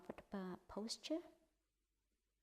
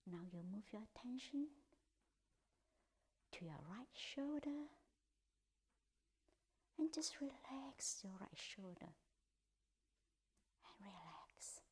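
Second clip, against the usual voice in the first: guided meditation; 2 semitones higher, 6 dB quieter than usual. Acted. Teacher guiding the group through a body scan, in a hushed whisper. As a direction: ease them through the body, part by part.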